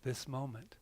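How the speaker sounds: soft, hushed "This moment."; hushed and calm; quiet answer. Acted. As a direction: say it very softly, settled. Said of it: "And he answers".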